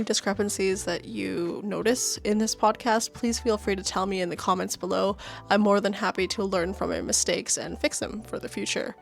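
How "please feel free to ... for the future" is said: ordinary talk, nothing marked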